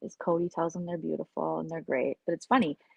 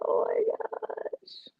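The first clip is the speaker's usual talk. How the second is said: exasperated gasp; much slower than usual. Real, not acted. Reacting to absurd drama.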